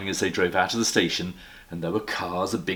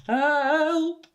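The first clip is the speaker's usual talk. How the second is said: very high, louder call for help; 6 dB louder than usual. Acted. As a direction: cry out from the bath. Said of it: "tried calling out".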